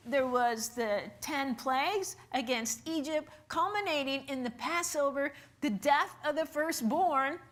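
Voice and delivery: ordinary talk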